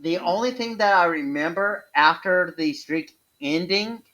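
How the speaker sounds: normal talking voice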